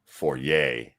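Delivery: foyer corrected to foy-YAY; French pronunciation. Real, not acted. Reader correcting his own word, foyer as French foy-YAY.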